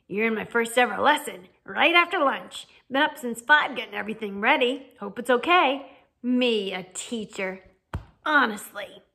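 hearty, proud excitement; eager. A narrator's reading. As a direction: share the news with big acted eagerness. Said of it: "he said eagerly".

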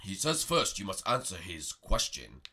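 low, slow mystic master; wise master voice. Acted. Master Prego translates dragon.

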